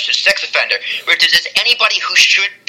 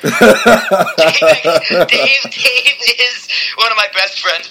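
higher, slow start, cracking up at your own joke; laughing; 7 semitones higher, much slower than usual. Real, not acted. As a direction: struggle to get the words out through real laughter. Cracking up at own middle-finger joke.

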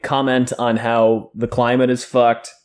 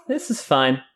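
laughing; 4 dB quieter than usual. Retold or imitated. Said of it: "the meme with the dog".

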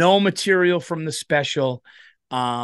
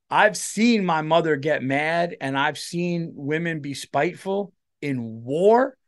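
ordinary delivery

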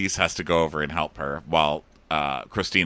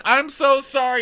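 very high, louder squeal; mock distraught apology; 5 dB louder than usual. Co-host as Jane apologising to John Paul, mock distraught. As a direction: imitate a frantic apology, pitched way up.

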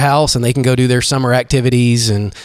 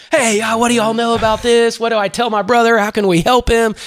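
a much higher, eager voice for the brother; brother voiced high; 10 semitones higher than usual. Brother calling the coach, voiced high and eager. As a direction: play the brother eager to help, pitched up.

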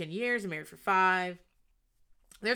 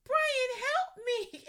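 mock yell for help; much slower than usual. Lila on the floor calling Brian for help, hammed-up high yell. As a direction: wail his name in a hammed-up plea. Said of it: "Lila yells".

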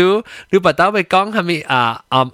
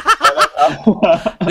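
higher burst of laughter; real amused laugh; 6 semitones higher than usual. Real, not acted. Amused during the banter.

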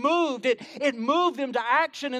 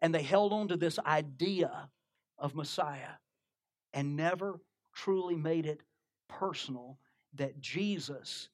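hushed preaching; 7 semitones lower, 8 dB quieter than usual. Real, not acted. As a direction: draw them in, nearly murmuring. Preacher's quiet intense close.

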